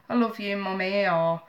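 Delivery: ordinary delivery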